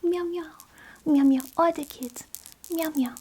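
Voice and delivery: playful high cat meows; high mock meows. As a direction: meow like a kitten for the grandkids. Meowing for the cat to kids.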